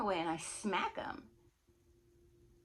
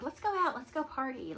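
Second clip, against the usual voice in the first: higher-pitched mimicry of an excited friend; eager party urging; 6 semitones higher than usual. Friend urging her to party after the concert, eager. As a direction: push to go out, voice lifted.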